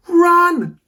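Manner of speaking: high, loud shout; urgent high-pitched command to run. Acted. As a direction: yell it at full voice to flee. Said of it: "shouted Jake".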